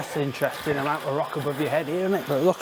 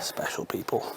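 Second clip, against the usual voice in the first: whispered; 9 dB quieter than usual. Real, not acted. Whispered repeat of own phrase.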